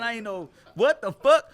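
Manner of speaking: normal talking voice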